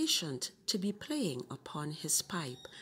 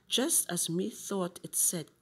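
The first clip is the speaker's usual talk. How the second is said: usual speaking voice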